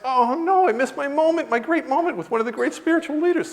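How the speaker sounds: very high wail of regret; mock lament. Man mourns his missed moment, mock-distraught. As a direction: whine it as a lost chance. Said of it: "so I felt bad".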